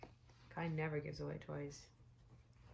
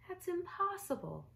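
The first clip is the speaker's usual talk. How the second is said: put-on much higher boyish voice for Spencer; a small boy protesting in disbelief; high little boy; 12 semitones higher than usual. Little Spencer to his mom, high boyish disbelief.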